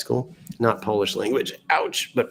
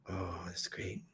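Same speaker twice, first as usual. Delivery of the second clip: breathless whisper, voice gone; 4 semitones lower, 19 dB quieter, slower than usual. Real, not acted. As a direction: barely voice it, fading out.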